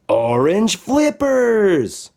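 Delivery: high, slow wonder on the reveal; magical reveal. A narrator's reading. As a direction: draw it out like a magic trick.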